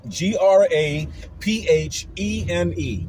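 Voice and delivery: letter by letter, slow and deliberate; spelled out slowly. Shown as a demonstration. Vlogger spelling the word out slowly for his viewers. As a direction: spell it out carefully, each letter separate. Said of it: "spelling out graphene".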